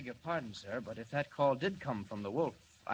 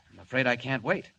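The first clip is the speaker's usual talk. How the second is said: ordinary talk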